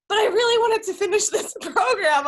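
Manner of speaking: quoting a friend while laughing, high and loud; laughing quote. Retold or imitated. Host retelling guest's words, laughing in disbelief. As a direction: giggle through the borrowed words. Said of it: "You're like".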